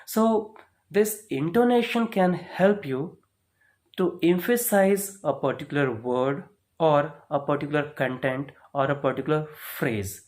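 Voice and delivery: ordinary delivery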